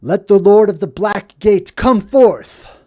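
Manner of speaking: slow, loud commanding king's call; Aragorn's challenge. Retold or imitated. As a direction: summon the enemy from the gate like a king. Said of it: "Strider says".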